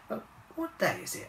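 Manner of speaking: high, dazed lemur; a hint of a sleepy lemur; Lemmy's voice. A narrator's reading.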